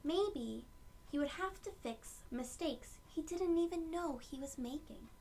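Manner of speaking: usual speaking voice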